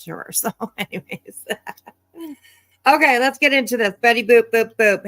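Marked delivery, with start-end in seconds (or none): 2.8-5.0 louder and higher, bouncy kickoff; excited loud intro. Real, not acted. Host gleefully kicking off the reaction video, loudly. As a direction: launch in with a burst of energy.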